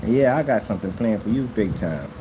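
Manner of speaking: ordinary talk, nothing marked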